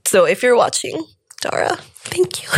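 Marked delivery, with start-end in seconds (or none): 1.4-2.5 whispered through real tears, high and soft; tearful thanks. Real, not acted. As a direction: choke out a tearful thank-you. Thanks late friend through tears.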